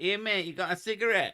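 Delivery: high, loud cheeky street-scrounger voice; council-house rat. Acted. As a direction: pipe up as a rat cadging a smoke. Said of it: "They live in council houses and go".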